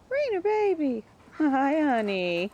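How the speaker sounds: very high sing-song voice for the dog. Real, not acted. Baby-talking to her dog.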